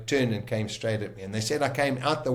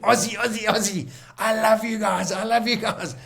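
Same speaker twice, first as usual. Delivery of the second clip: high, loud, laughing cheer; euphoric cheering; 11 semitones higher, 4 dB louder than usual. Retold or imitated. Cheering the Aussie crew who rescued him, euphoric. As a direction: whoop it up with joy and relief.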